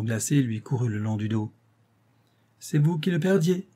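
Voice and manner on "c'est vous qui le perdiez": high, mocking retort. A narrator's reading. Actress turning it back on the financier, mocking. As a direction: jeer with the pitch lifted. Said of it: "railla-t-elle".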